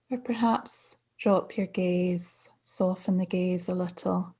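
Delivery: calm, unhurried meditation guidance with long pauses; guided meditation. Real, not acted. Mindfulness coach settling listeners into practice, calm meditation voice. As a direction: ease listeners gently into stillness, phrase by phrase.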